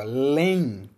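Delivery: "Lem" said alone, loud and clear; loud syllable. Shown as a demonstration. Teacher modelling second syllable answer, loud syllable. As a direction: call out "Lem" as its own syllable. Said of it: "Qual é a segunda sílaba?".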